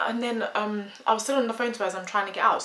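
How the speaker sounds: ordinary talk, nothing marked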